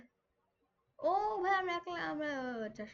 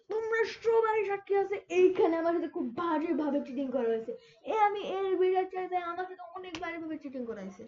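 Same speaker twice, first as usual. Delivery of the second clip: loud protest; 3 semitones higher, 6 dB louder than usual. Real, not acted. Gamer tells viewers he was cheated, loud indignant protest. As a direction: call everyone to witness the injustice.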